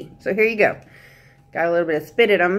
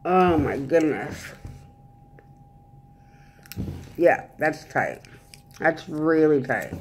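talking while fitting aligners; speaking with new aligners in; 2 semitones lower than usual. Real, not acted. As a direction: fingers at teeth, tight trays. Seen 1: She presses clear aligners onto her teeth, speaking with them in.

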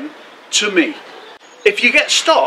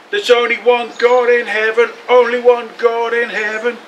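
chanted crowd slogan, slightly raised; shouted slogan; 4 semitones higher than usual. A narrator's reading. Narrator voices the crowd in Jerusalem, chanting a slogan. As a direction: chant it evenly at a higher pitch. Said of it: "they're shouting different slogans".

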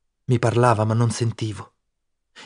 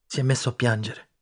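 ordinary delivery

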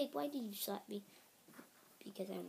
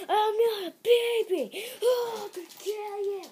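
put-on high, loud babyish protest; tantrum crying; 10 semitones higher, 11 dB louder than usual. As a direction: wail it like a furious crybaby. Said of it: "stop crying like a baby".

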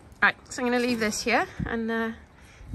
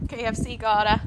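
ordinary delivery

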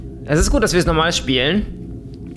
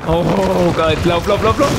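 urgent, rushed cries to run; being chased; 2 semitones higher, 3 dB louder, slower than usual. Real, not acted. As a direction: hurry the character along in a panic. In-game chase, urging character to run.

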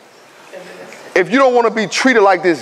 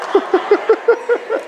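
short high laughter. Real, not acted. Laughing at student's remark.

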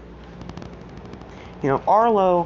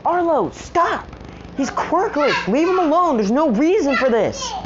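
Seraphina pleading; 10 semitones higher, slower than usual. Retold or imitated. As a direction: beg him to stop, in a girl's voice. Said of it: "Seraphina's like".

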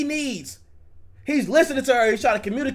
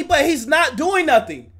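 normal talking voice

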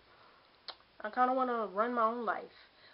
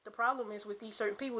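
normal talking voice

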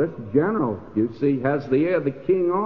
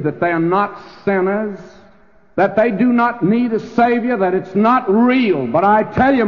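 preaching climax; 6 semitones higher, 5 dB louder than usual. Real, not acted.

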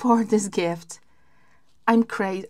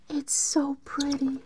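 awed whisper; 3 semitones higher, 6 dB quieter than usual. Real, not acted.

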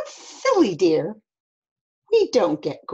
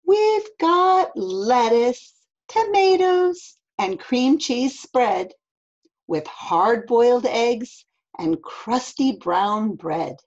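animated mom; 6 semitones higher, 6 dB louder, slower than usual. Acted. Mom lists food, then gasps.

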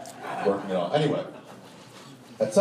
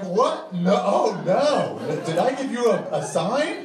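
shocked protest; 10 semitones higher, 5 dB louder, slower than usual. Retold or imitated. Reacting to being grabbed.

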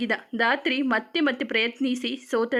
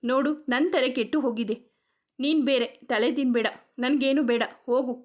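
ordinary delivery, nothing marked

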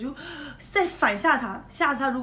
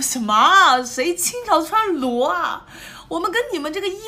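indignant; 5 semitones higher, 5 dB louder than usual. Retold or imitated. Indignant retort to the pirate.

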